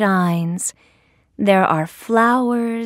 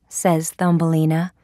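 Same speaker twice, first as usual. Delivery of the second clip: plain reading voice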